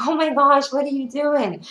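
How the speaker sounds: high, incredulous exclamation; amazed disbelief. Retold or imitated. Imitating the trainer's reaction to her plank, amazed disbelief. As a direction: imitate someone astonished, pitched high.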